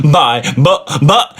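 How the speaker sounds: rising, stammering protest; hammed-up flustered sputter; mock grunt. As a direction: fumble for words, caught out. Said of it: "he grunted".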